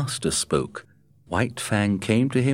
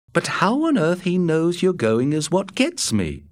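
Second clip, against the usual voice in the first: higher, puzzled Matt voice; 11 semitones higher than usual. A narrator's reading. Matt puzzling over the wolf to Scott, higher and puzzled. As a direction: pitch Matt up, wondering aloud. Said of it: "was Matt's answer".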